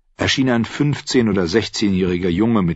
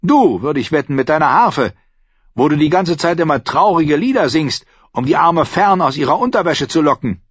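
high, raised-voice scolding; bitter accusation; 8 semitones higher, 4 dB louder than usual. A narrator's reading. Innkeeper blaming the harpist for seducing her, angry. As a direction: accuse the singer indignantly. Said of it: "warf Tom einen bitterbösen Blick zu".

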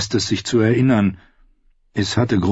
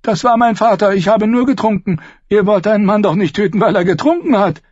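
high, loud, frantic excuse; desperate pleading; 13 semitones higher, 5 dB louder, faster than usual. Acted. Captive begs not to be killed, desperate. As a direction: plead urgently, shifting the blame.

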